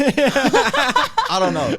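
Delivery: very high, slow, laughing drawled accent attempt; attempted Canadian accent. UK guest tries a Canadian accent and cracks up laughing. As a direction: stretch it out, laughing through a put-on accent. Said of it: "you sound like you're from Texas".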